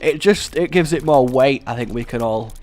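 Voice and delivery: ordinary talk, nothing marked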